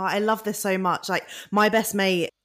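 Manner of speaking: normal talking voice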